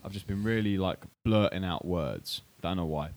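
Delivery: ordinary delivery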